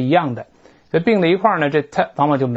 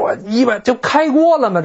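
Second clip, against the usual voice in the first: mock shock; 8 semitones higher than usual. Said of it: "我说".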